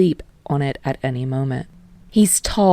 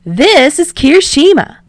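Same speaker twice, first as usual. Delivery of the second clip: much higher, loud and bubbly; excited; 11 semitones higher, 10 dB louder than usual. Acted. Yamada introducing the kids to Shota, loud and excited. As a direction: gush like an eager host.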